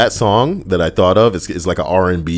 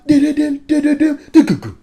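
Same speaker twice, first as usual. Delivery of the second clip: pitched-up drum sounds; vocal drum; 4 dB louder than usual. Shown as a demonstration. Host showing the drum part, with vocal drum sounds. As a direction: tap out the drums with your voice. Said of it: "That's the drum part".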